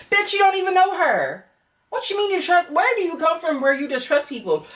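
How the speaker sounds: incredulous rant. Real, not acted. Ranting at Kaylor's trust.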